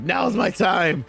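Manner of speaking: slow mock shout. Mimicking eager player's line.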